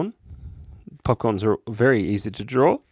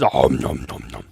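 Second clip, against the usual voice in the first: low munching noises; playful nom nom; cat munching; 11 semitones lower than usual. Pretend. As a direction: voice the cat chewing. Cat eating popcorn.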